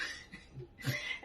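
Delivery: unvoiced soft laugh; breathy laugh. Real, not acted. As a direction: laugh to yourself. Laughing at the story.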